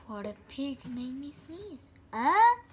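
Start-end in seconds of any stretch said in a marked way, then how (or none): none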